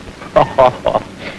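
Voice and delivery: a short scoffing laugh; dismissive laugh. Acted. Suspect to the detective, dismissive laugh. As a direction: laugh it off as a joke.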